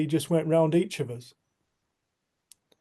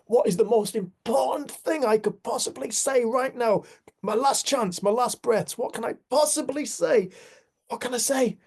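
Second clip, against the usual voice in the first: high-pitched, impassioned imagined last words; urgent pleading; 9 semitones higher, 3 dB louder than usual. Retold or imitated. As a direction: voice a desperate search for what to say. Voicing dying man's urgent last thoughts.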